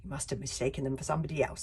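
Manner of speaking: quick, backing-down reply. Acted. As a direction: hurry the excuse. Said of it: "with less of a bellow".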